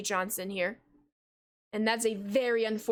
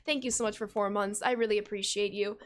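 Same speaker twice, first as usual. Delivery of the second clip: ordinary talk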